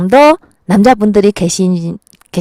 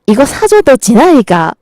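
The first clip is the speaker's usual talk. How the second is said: imitated gruff Gyeongsang retort, higher in pitch; Gyeongsang man's accent; 4 semitones higher than usual. Shown as a demonstration. Man retorts to Gyeongsang woman, mock Gyeongsang accent. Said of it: "경상도 여자한테는 이렇게 말한답니다".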